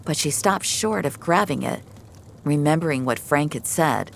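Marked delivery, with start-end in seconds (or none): none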